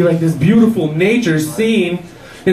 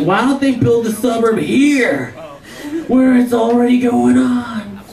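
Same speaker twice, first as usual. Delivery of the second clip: slow, laughing, exasperated; 6 semitones higher, slower than usual. Retold or imitated. Comic mocks the planners, asks slowly and laughing. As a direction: plead the obvious slowly.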